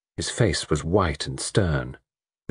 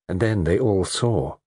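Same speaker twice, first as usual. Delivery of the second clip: usual speaking voice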